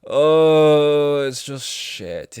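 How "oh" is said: exasperated groan. Real, not acted. Loud exasperated oh.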